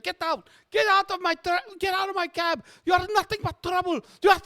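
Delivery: very high-pitched, hammed-up rant; cabbie going bonkers. Cabbie throwing passengers out of his cab, going bonkers. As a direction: rant in a piercing high voice, dissolving into gibberish. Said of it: "going bonkers about his cab".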